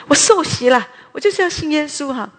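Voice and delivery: 高声倔强地宣告; defiant declaration. Retold or imitated. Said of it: "我就说". (倔强地高声宣告)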